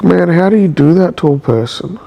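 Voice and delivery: ordinary talk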